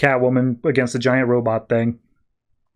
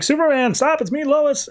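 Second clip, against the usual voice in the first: mock panicked plea; 13 semitones higher than usual. Lois, plummeting, pleads with Superman to stop, in mock panic. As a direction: imitate a high-pitched cry for help. Acted plea while falling.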